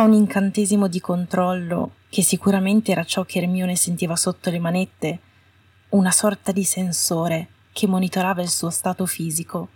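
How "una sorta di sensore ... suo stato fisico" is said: usual speaking voice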